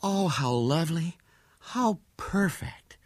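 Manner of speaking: high, slow sigh of delight; enraptured, drawn-out wonder; rapturous sighing. A narrator's reading. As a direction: sigh it out, lost in admiration. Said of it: "with a sigh of rapture".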